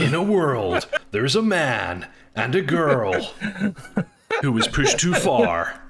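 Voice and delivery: slow trailer announcer, half laughing; dramatic movie trailer read. Pretend. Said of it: "trailer voice".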